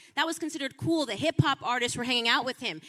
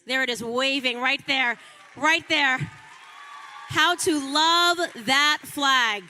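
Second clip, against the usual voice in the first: rousing shout; 5 dB louder, slower than usual. Real, not acted. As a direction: rouse the crowd pointing at the flag. Rallying the crowd at the flag.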